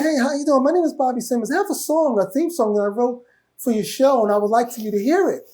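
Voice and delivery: high, softened pop-star impression; lilting high voice, gently polite. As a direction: imitate the singer politely pitching on the phone. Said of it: "In my [name] voice".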